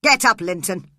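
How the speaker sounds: father shouting at his son to get up; angry shout. A narrator's reading. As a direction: snap the order at the boy. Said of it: "He shouted angrily to his son".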